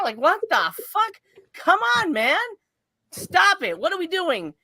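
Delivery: high-pitched, pleading exasperation; incredulous complaint at a silly film; exasperated disbelief. Real, not acted. Exasperated rant about the effects.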